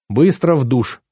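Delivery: usual speaking voice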